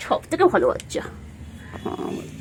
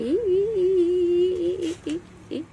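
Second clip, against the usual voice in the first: high squeal; 10 semitones higher, much slower than usual. Real, not acted. Squealing at pet.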